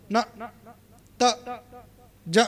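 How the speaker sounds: ਨਾ, ਤ, ਜ each cut short with no full vowel; clipped letters. Shown as a demonstration. Teacher demonstrating short letter sounds to students. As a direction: clip ਨਾ, ਤ, ਜ short, one by one. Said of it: "ਉੱਥੇ ਅਸੀਂ ਸੰਪੂਰਨ ਉਚਾਰਨ ਨਾ ਨਹੀਂ ਕਰਾਂਗੇ".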